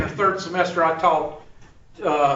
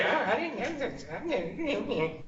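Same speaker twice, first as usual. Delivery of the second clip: mock whine; 12 dB quieter, slower than usual. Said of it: "somebody's gonna say".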